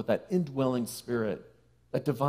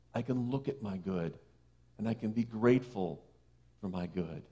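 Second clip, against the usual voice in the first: soft calm self-talk; 4 dB quieter than usual. Retold or imitated. Congregant telling themselves to see the good, softly. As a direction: let it ease down to a soft close. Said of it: "we can say".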